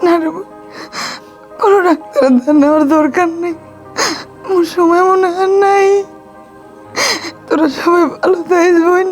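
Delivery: tearful, resigned farewell; weeping, halting and weak; crying. Acted. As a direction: sob between phrases as if saying goodbye. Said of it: "আপনি কান্দেন না".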